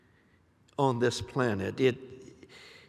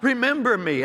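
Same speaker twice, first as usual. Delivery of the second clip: a raised, pleading call to God; mock cry out; 10 semitones higher, 5 dB louder than usual. Preacher voicing David calling to God, raised plea. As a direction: lift the voice as David crying out. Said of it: "who cried out".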